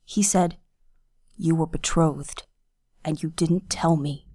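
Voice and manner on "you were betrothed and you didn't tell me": restrained anger. A narrator's reading. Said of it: "his anger took hold of his mouth".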